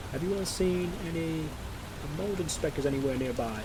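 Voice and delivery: slow, distant muffled call; like someone calling from downstairs; faint muffled voice. Acted. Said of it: "Very faint, muffled voice".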